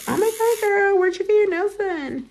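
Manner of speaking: very high, excited impressed voice; gushing customer. Pretend. Customer in public, gushing to the nail tech. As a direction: squeal it like an amazed fan spotting great nails. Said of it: "they're gonna be like".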